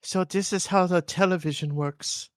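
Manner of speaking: high-pitched mock Icelandic accent; playful Icelandic-accented impression. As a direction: put on a high Icelandic singer's voice. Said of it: "they all sound like [name]".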